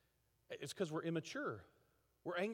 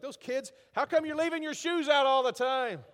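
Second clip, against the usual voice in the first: sharp, much louder and higher complaint about the shoes; exasperated scolding; 8 semitones higher, 13 dB louder than usual. Retold or imitated. Pastor imitates himself scolding the kids, loud and exasperated. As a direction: snap at the kids like a fed-up parent.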